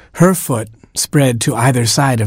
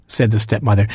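usual speaking voice

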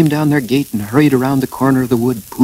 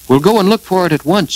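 higher, louder call; 9 semitones higher, 6 dB louder than usual. A narrator's reading. Christopher Robin calls out to Eeyore, loud and high. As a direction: call it out briskly to a friend. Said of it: "he called out to Eeyore".

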